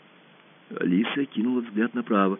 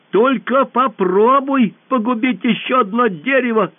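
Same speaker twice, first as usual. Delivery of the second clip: high, loud warning; girl warning the monster about the trees; threatening shout; 10 semitones higher, 6 dB louder, slower than usual. A narrator's reading. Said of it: "крикнула Алиса".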